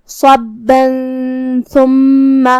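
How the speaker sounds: صبا joined to ثم through a held nasal hum; slow chant, hide the n of صبا in a hum before ثم; ikhfa with ghunna. Shown as a demonstration. Teacher showing ikhfa, humming between صبا and ثم. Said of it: "تنوین چھپاتے ہوئے لیکن غنہ".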